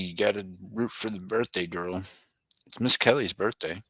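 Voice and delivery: ordinary talk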